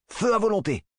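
high-pitched firing order called out; battle command. Acted. Commander orders squad to fire.

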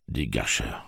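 plain reading voice

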